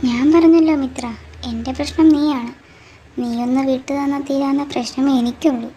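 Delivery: loud, hostile shout; angry shout. Acted. A jealous girl shouting at her rival, loud and angry. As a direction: fling the words at her rival. Quarrel with rival over Devan.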